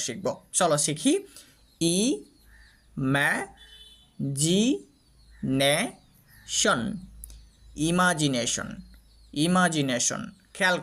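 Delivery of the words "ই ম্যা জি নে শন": "ই ম্যা জি নে শন" broken into separate syllables with long pauses. Shown as a demonstration. Teacher splits the word for learners, syllable by syllable. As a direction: say ই, ম্যা, জি, নে, শন one at a time. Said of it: "সিলেবলের মধ্যে পাট পাট পাট পাট করে".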